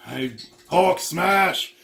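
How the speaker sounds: hammed-up brute talk; Hulk voice. Smashing the ketchup bottle, in slow mock Hulk voice.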